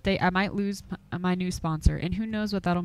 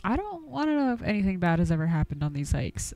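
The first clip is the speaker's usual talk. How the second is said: ordinary talk, nothing marked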